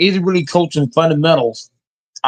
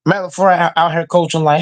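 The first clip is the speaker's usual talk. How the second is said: normal talking voice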